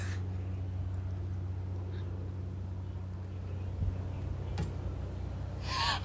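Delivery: high, breathless, silent laugh; helpless laughter with no voice; long wheezing laugh. Real, not acted. Laughing at the candles story.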